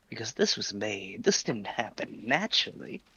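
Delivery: lightly voiced Dizzy character; a hint of the Dizzy character voice. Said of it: "You're still doing the Dizzy voice".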